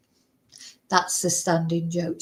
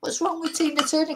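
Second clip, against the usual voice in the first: much higher, incredulous retold reaction; high-pitched acted disbelief; mock bafflement; 8 semitones higher, 3 dB quieter than usual. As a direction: imitate a puzzled crowd, pitched right up. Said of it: "everyone was like".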